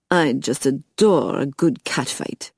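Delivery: lightly put-on French accent, curious; a hint of a French vampire sizing her up. A narrator's reading. Bouchard voiced, French accent.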